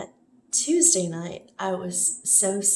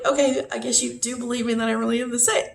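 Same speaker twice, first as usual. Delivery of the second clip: quick, laughing through the words; half-laughing resignation; laughing in disbelief; 3 semitones higher, faster than usual. Retold or imitated. Telling the nurses she's relieved they believe her, laughing. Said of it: "I'm like".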